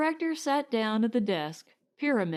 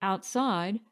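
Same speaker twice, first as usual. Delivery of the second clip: ordinary delivery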